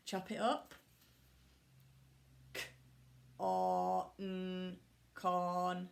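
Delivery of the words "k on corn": choppy robot sounds, a hint only. Shown as a demonstration. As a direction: segment it like a toy robot. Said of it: "Do you like my robot voice?".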